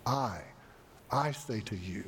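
"I" alone, pause, then repeated; deliberate, weighty stress on "I"; emphatic I. Retold or imitated. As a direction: set "I" apart before "I say to you", letting the end drop. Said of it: "when Jesus said to his disciples".

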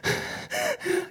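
shuddering, voiceless gasps; imitated sobbing breaths. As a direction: heave mock sobbing breaths. Arriving home in tears.

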